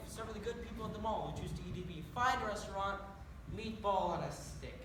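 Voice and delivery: Irish brogue, slowing on "meatball on a stick"; proud Irish lilt about "me fine restaurant"; Irish accent. Acted. Irish leprechaun talking up his mall restaurant in a proud Irish brogue. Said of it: "speaking in an accent".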